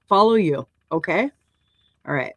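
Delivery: normal talking voice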